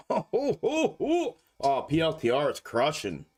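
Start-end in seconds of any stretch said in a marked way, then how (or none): 0.1-1.4 high, rising oh ho ho chuckle; a gloating, high-pitched ho ho ho; gleeful laugh. Real, not acted. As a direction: laugh with delight, climbing higher. Gloating over gains.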